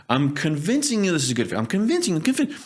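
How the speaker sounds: hammed-up pushy seller climbing in pitch; pushy salesperson. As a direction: mimic a salesman forcing it. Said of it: "salespeople are trying to convince you".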